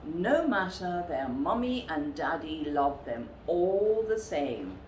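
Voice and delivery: ordinary delivery, nothing marked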